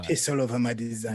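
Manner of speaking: put-on light French accent as snobbish Apple; mock French accent. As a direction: sneer it in a faint French accent. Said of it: "I gave a French accent to it".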